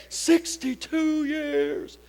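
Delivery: put-on slow, drawn-out elderly voice; proud old-timer. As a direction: stretch it like a proud old man. Old couple answers.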